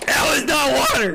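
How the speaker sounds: high, strained cry while cracking up; laughing protest. Real, not acted. Drinker repeats it was not water, laughing protest. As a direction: insist through real laughter and coughing.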